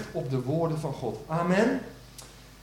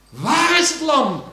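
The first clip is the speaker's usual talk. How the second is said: slow ringing question; 11 semitones higher, 10 dB louder, slower than usual. Shown as a demonstration. Preacher to congregation, posing Isaac's question, slow and loud. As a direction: call out slow and high, WAAR stretched and loud. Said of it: "En hoe luidt die vraag?".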